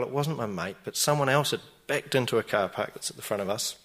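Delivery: normal talking voice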